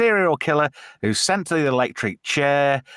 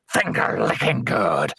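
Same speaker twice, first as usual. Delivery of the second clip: slow, put-on villain voice; killer's one-liner; 2 semitones lower, slower than usual. Host quotes killer after biting fingers off, slow put-on voice. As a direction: draw it out as a mock catchphrase. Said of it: "and he's like".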